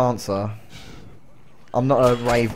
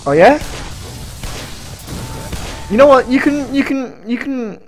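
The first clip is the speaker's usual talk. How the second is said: excited; 11 semitones higher than usual. Real, not acted. Excited challenge to enemies.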